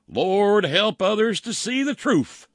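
high, loud, drawn-out plea to the Lord; mock fervent prayer. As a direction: wail it out like a mock true believer. Mock-reading a believer's fervent post.

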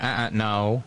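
low, understated imitation of an aide; aide refusing. Aide stopping her boss, lightly imitated low refusal. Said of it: "her aide was like".

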